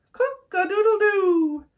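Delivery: high, slow rooster crow with a laugh. A narrator's reading. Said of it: "crowed Rooster".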